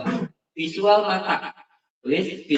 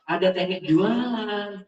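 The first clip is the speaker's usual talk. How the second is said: higher, drawn-out TEKNIK JUALAN; sales tune; 5 semitones higher, slower than usual. Shown as a demonstration. Trainer showing how sellers talk, lilting TEKNIK JUALAN. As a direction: stretch TEKNIK JUALAN in a sing-song. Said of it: "ada teknik ngomongnya".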